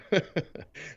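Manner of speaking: brief amused chuckle; short laugh. Real, not acted. Host amused by caller's party denial, brief chuckle. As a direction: laugh lightly under your breath.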